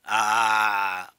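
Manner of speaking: आ held long and loud, throat vibrating; long vowel demo. Shown as a demonstration. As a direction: sustain आ loudly so the vibration is heard. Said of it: "हल्का सा कंपन हो रही है".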